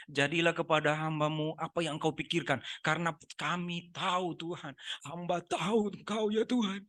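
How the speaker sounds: slow, put-on weepy prayer; tangis dibuat-buat. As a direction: plead with God in fake tears, drawn out. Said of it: "Dibuat-buat seperti menangis pokoknya". (doa merengek pura-pura menangis)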